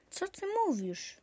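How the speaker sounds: high-pitched startled question; shocked cry. Acted. Paweł hears the tree will be taken, high startled disbelief. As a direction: jump up in disbelief. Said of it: "zawołał".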